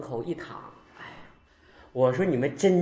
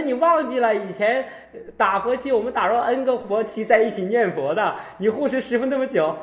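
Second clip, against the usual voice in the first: raised, louder voice with laughter in it; insistent reminding; 7 semitones higher, 5 dB louder than usual. Retold or imitated. Master reminds forgetful devotee of shared retreats, insistent. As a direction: press the reminder on a forgetful listener.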